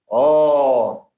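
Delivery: "oh" long, heavy and rounded; thick vowel. Shown as a demonstration. Teacher showing how the sound turns heavy, thick vowel. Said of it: "jadi tebal".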